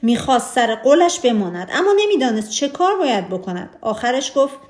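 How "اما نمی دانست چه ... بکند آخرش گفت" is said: plain reading voice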